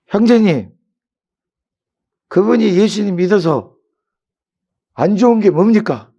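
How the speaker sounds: high, shouted rebuke; loud remonstrating. Retold or imitated. Preacher confronting a drunk brother at church, loud high shout. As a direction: raise your voice high and demand an answer.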